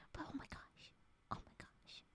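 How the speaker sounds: soft, breathless whispered awe; awed whisper. Real, not acted. Streamer gushes over frog emote in awed whisper. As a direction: whisper it, overcome by cuteness. Overwhelmed by cuteness.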